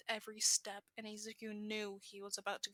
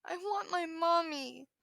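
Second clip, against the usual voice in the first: put-on high, loud, slow crying wail of a small girl; wailing child; 7 semitones higher, 7 dB louder than usual. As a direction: cry it out like a lost little kid. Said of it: "the kid wails".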